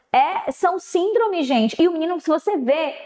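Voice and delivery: normal talking voice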